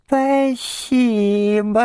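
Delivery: high, drawn-out squeaky thanks; tiny imp calling from the yard, very slowly; thin little voice. A narrator's reading. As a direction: stretch each syllable in a thin high pipe. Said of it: "отвечал мне со двора тоненький голосок".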